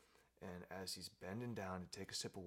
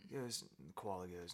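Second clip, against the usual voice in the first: plain reading voice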